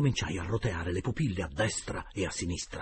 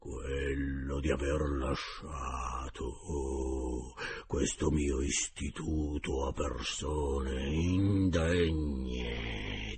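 slow, lower ghostly rebuke with anger; a bit louder, angry; 6 semitones lower, much slower than usual. A narrator's reading. Dead founder's spirit rebuking the directors, slow angry ghost voice. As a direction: scold them from the grave. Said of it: "con voce un po' più alta e con accento adirato".

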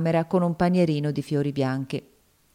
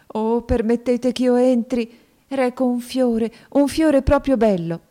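put-on higher, slow, begging girl's voice; pleading girl; 8 semitones higher, 5 dB louder than usual. A narrator's reading. Topsy begging the maid to let her in, pleading. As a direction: beg to be let in, slowly and high.

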